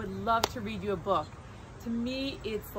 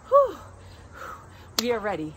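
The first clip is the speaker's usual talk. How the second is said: normal talking voice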